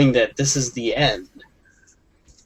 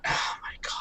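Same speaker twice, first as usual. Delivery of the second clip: awed whisper; 12 dB quieter, faster than usual. Real, not acted. Overwhelmed by movie ending.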